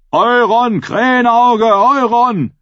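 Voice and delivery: high, slow rallying yell; yelled acclaim. A narrator's reading. Rote Rudra cheers for Euron, high drawn-out yell. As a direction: yell the name out, stretching it. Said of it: "Schrie der rote Rudra".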